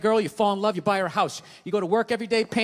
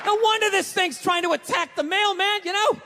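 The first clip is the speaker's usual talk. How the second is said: ranting shout; 9 semitones higher than usual. Retold or imitated. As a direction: blow up at the owner in disbelief. Trainer ranting about the dog.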